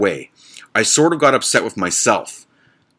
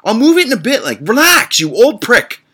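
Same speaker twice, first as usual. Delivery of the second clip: mocking retort; 12 semitones higher, 4 dB louder than usual. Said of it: "So I say".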